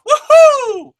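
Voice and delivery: loud, very high woohoo; excited cheer. Real, not acted. Cheering for Angelina Love's name with a loud, high whoop. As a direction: whoop like a thrilled fan.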